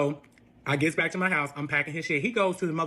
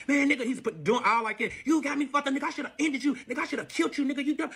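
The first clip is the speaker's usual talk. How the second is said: mock angry boyfriend; 7 semitones higher than usual. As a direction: ham up his furious rant, pitched high. Boyfriend voiced threatening her.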